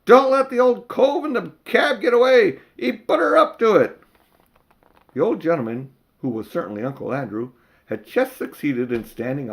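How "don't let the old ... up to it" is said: high, loud shout from the crowd; a bystander shouting not to let him escape; calling out. Acted. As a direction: yell a warning across the street. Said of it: "someone else called out".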